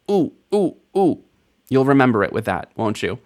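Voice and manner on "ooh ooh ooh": high, playful staccato "ooh" on each bump; mock little oohs, one per stair. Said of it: "falling down the stairs on your butt".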